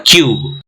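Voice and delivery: क्यूब with a drawn-out यू; long-u cube. Shown as a demonstration. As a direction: stretch the यू in क्यूब. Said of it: "वाई ओ यू की तरह".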